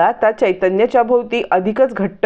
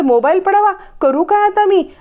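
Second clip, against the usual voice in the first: frustrated outburst; 10 semitones higher than usual. A narrator's reading. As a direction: vent at herself, pitch jumping up. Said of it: "स्वतःवरती चिडचिड".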